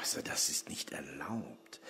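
hushed, breathy whisper to his father. Acted. Son whispers a warning to his father in the cell. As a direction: keep it down so the guards don't hear.

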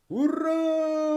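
very high, drawn-out hurrah; cheering. A narrator's reading. Spectators cheering the winning boy, high long cheer. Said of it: "закричали глядачі".

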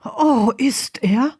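slow, high, incredulous question; disbelieving. A narrator's reading. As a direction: draw it out in amazed disbelief. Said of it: "fragte die Stimme ungläubig".

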